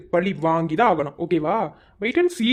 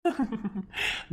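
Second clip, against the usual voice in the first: cruel laugh; 2 semitones higher, 8 dB quieter than usual. Acted. Villain mocks her crying.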